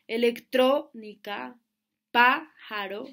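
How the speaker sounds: "electrónica, pájaro" loud on TRÓ and PÁ; stressed accented syllable. Shown as a demonstration. Teacher giving esdrújula examples, "electrónica, pájaro" hit on TRÓ and PÁ. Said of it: "tiene el acento en la penúltima sílaba".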